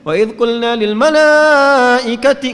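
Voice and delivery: overblown, forced loud recitation; wrong way, too high. Shown as a demonstration. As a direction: demonstrate the unpleasant overpitched reading. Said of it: "nggak enak".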